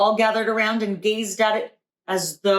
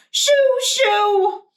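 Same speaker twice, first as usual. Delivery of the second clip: high, loud shoo; shooing shout; 4 dB louder than usual. A narrator's reading. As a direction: shoo the birds away. Grandma chasing birds off pie.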